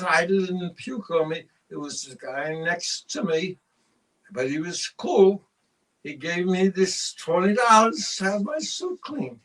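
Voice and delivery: put-on slow, drawn-out drunken explanation; drunken excuse. Drunk husband giving wife his excuse, slowly. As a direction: take your time over each phrase, acting drunk.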